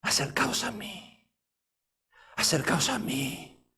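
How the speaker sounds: very hushed, slow invitation; softly said. Acted. Elijah gently drawing the people in, very softly. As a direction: say it barely above a breath, drawn out. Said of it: "Se lo dijo despacito".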